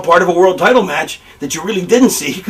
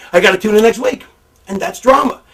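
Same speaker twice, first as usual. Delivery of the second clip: normal talking voice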